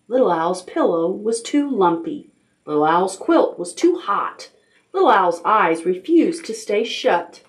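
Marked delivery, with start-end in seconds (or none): none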